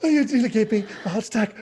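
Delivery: very high, breathless fright; acted high-pitched shock; startled gasp. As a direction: gasp and squeak like someone caught off guard. Sister startled by sudden greeting.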